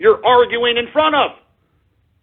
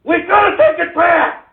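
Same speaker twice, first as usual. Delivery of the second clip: furious shout; 7 semitones higher than usual. Real, not acted. Host shouting at an audience that won't answer, furious shout. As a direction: roar it into the void.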